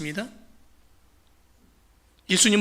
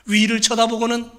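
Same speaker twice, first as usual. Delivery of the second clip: ordinary talk, nothing marked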